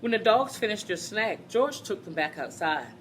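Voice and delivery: lively, animated storybook reading; reading to children. Acted. Teacher reading a picture book to the class, animated. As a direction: read it out to a class of kids with bounce.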